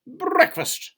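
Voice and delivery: high, loud announcement; calling out breakfast. A narrator's reading. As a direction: sing it out proudly. Cousin announcing the meal.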